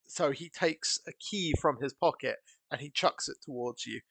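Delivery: normal talking voice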